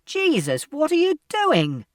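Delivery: high, loud, slowed shout of alarm; a stranger shouting in shock from the platform; startled shout. A narrator's reading. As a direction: yell at the boy on the tracks. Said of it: "someone shouted".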